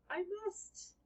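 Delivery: a hint of a put-on character voice; Harry's voice. Harry answering the boy, lightly voiced. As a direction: give him a solemn little voice.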